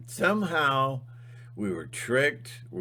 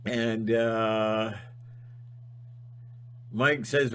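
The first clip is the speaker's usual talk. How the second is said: ordinary talk, nothing marked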